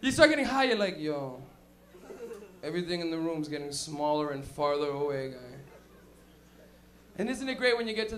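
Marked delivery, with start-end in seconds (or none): 1.0-5.6 lower, spacey stoner voice; a tripper noticing the room shrink, in a low dazed voice; spaced-out stoner. Retold or imitated. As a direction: drift through it, dazed.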